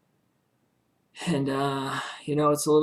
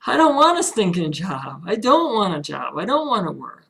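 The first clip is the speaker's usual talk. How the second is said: emphatic refusal; 4 semitones higher, 7 dB louder than usual. Real, not acted. Defiant rejection of getting a job.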